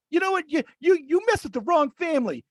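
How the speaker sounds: a hint of a shrill threatening mother, high; mock angry mother. Caller mocks the angry mother's threat, high.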